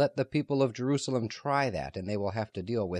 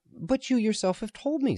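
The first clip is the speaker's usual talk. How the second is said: much higher, pressing; urging; 13 semitones higher than usual. A narrator's reading. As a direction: push the point eagerly. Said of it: "Simon urged".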